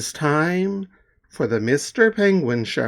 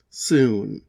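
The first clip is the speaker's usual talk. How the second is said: plain reading voice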